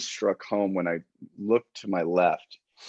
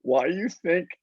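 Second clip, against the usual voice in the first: much higher mock whiny voice; imitating the wife; 12 semitones higher than usual. Husband imitates his crying wife under barbed wire, high whine. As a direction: squeak it like the wife close to tears, with a laugh. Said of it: "She goes".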